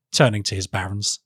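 usual speaking voice

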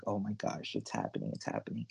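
low, under-the-breath aside; hushed mock worry. Voicing a fan watching the team go down, hushed. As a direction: drop to a near whisper, trailing away.

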